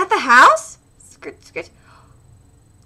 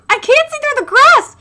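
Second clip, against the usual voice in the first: normal talking voice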